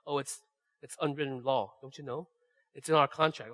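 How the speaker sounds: lightly voiced wife, playfully reproachful; wife's teasing reminder. Husband mimics his wife quoting their unwritten contract, softly. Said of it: "she always says stuff like".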